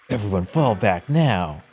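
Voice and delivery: slow sultry villainess voice; man playing a slow, seductive shadowy woman; sexy shadowy lady voice. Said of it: "That's your sexy, shadowy lady voice?".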